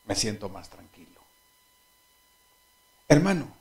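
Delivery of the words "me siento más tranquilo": soft relieved thanks; a calm, quiet thank you; son relieved. Retold or imitated. Son calmed by his father's promise, softly thankful. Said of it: "Me dijo".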